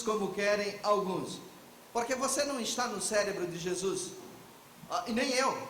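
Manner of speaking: ordinary talk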